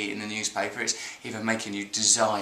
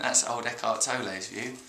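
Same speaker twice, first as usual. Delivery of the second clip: ordinary talk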